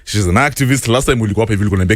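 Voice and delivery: usual speaking voice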